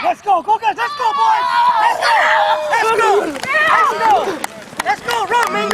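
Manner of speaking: high, loud sideline cheering; hype shout. Real, not acted. Coach firing up team before play.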